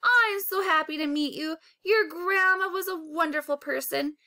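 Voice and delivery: high, tearful and comforting; touched elderly lady on the verge of crying; tearful old woman. A narrator's reading. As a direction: comfort the boy through tears.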